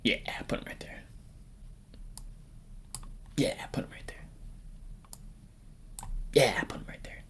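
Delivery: hushed, whispered repeat; whispering. Pretend. Ranker deciding where to put him, whispering. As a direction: whisper it under your breath.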